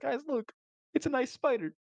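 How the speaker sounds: high delighted voice. Retold or imitated. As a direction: coo it like a kid with a pet. Friend shows off tamed spider.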